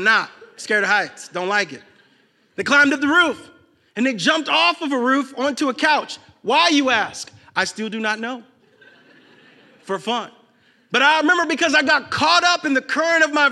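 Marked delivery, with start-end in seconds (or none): none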